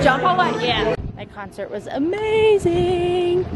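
high, drawn-out, held "amazing"; gushing. Real, not acted. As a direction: gush it slowly, stretching the last word high. Gushing right after the concert.

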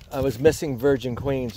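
usual speaking voice

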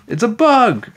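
high, loud squeal of alarm; shriek. A narrator's reading. Emily fleeing a bug, panicked shriek. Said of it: "Emily shrieked".

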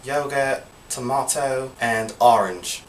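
English accent, computer and yogurt without final r, tomato as tomahto; English accent word list, r dropped. Shown as a demonstration. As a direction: try a non-rhotic English accent. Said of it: "the one without pronouncing the T".

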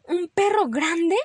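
loud, high, slow incredulous question; mother rabbit's astonished exclamation; exclaiming. Acted. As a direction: stretch it out in disbelief. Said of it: "exclamó mamá".

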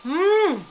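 loud, high hum of pleasure; delighted mmm. Real, not acted. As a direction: savor it with a big mmm. First bite of tteokbokki.

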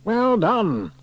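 high, falling cheer of congratulation; hearty praise. A narrator's reading. Station officer greeting the returning climbers, hearty praise. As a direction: congratulate them with gusto.

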